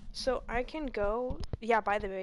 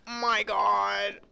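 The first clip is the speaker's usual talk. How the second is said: startled gasp; 14 dB louder, much slower than usual. Real, not acted. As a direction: gasp at the sudden scare. Startled by the enemy.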